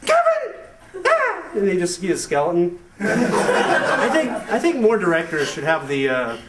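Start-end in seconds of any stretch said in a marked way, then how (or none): 0.1-1.4 very high mock parent shriek; hammed-up horrified parents, sky-high pitch. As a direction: call the child's name, then scream in falsetto. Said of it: "his parents come home and they're like".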